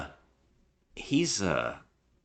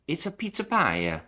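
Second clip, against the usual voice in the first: imitating a hammy Italian accent, pitched way up; mock a typical movie Italian accent, high; bad Italian accent; 9 semitones higher than usual. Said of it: "he's doing that voice".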